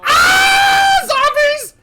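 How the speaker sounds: very high, loud shriek; piercing hammed-up terror; terrified scream. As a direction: scream the words in sheer panic. Screams on seeing zombies.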